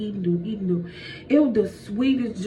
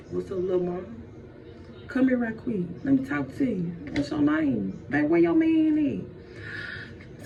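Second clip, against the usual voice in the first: smooth-talking snake; 2 semitones higher than usual. Acted. Snake hitting on Eve, smooth-talking. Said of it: "He slid her real smooth".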